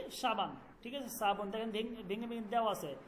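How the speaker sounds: ordinary talk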